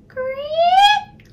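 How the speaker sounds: creaking floorboard. A narrator's reading. Sound of the floorboard.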